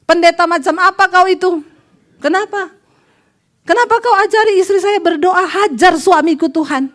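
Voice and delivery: high-pitched acted outrage; mock angry confrontation; indignant husband. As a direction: demand an explanation down the phone.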